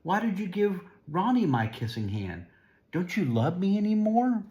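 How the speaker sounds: put-on high, rising little child's plea; a small boy near tears asking if he's still loved; hurt child pleading. Chester asks his mother if she still loves him, high and hurt.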